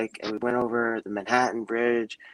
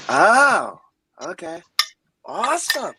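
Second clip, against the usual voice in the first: high, loud mock-cheery awesome; jokey relief on learning it's just a game; sarcastic relief; 7 semitones higher, 7 dB louder, slower than usual. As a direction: fake enthusiasm, pitch jumping up. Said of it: "I was like".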